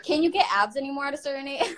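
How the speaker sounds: ordinary delivery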